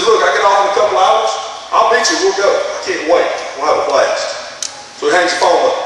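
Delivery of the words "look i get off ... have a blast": mildly excited, higher at first; eager and higher, easing back to normal. Retold or imitated. Excited young man accepting his friend's party invitation, mildly eager. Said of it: "the young man was excited".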